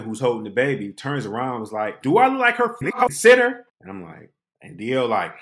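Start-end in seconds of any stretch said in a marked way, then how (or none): 2.0-3.7 higher, louder, indignant comeback; sassy retort. Retold or imitated. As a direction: snap back at him with attitude. Woman snaps back.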